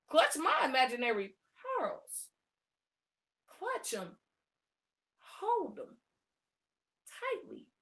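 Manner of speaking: hushed, halting mock horror; mock pearl-clutching. Woman clutching imaginary pearls, hushed mock horror. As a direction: trail into soft, prim pretend shock.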